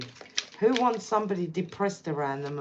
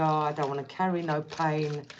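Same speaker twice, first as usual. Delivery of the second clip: ordinary talk, nothing marked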